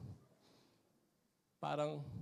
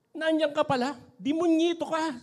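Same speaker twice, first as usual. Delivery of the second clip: high, loud mother's scold; 9 semitones higher, 8 dB louder than usual. Retold or imitated. Mother scolds her son at the door, high and loud. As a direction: bark it at the runaway son. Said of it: "sabi nung nanay".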